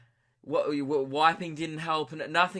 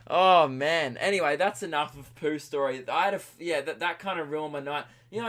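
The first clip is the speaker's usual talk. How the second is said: ordinary talk, nothing marked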